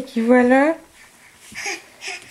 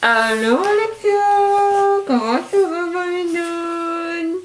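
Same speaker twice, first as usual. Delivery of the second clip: through a tube; 4 semitones higher than usual. Real, not acted. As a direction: voice echoing down a tube. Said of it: "elle va parler dans le tube".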